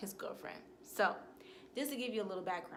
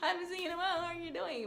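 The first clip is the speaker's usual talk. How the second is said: much higher, bright put-on politeness; fake friendly greeting; 9 semitones higher than usual. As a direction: greet him sweetly while faking it. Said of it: "he know that fake".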